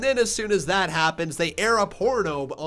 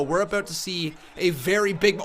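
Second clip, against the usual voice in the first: ordinary delivery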